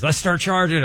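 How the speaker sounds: high, playful imitation of the partner; mock eager partner. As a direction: pitch it up like a pushy business partner. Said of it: "He was wanting to make it a business".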